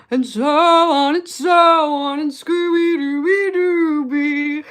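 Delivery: slow, loud, high crooning [name] voice; [name] impression. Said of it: "That's my [name] impression".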